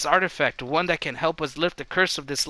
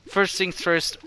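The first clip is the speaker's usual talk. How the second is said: ordinary talk, nothing marked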